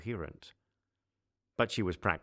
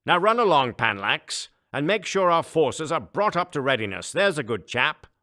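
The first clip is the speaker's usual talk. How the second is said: higher, louder, patronising dismissal; condescending emperor; 9 semitones higher, 11 dB louder than usual. Acted. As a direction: shoo away an underling.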